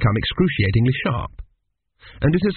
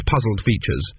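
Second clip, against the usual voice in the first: usual speaking voice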